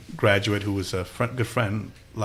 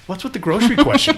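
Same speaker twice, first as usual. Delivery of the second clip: high, louder mock bewilderment; baffled; 14 semitones higher, 6 dB louder than usual. Candidate asked about groceries once more, comically baffled. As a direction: push it up high in comic disbelief. Retold baffled reply to repeated question.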